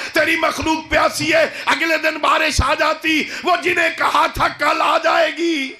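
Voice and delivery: impassioned preacher voicing a desperate prayer for rain; full-force preaching.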